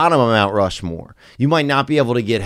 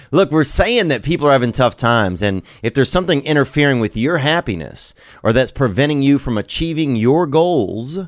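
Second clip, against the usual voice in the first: ordinary delivery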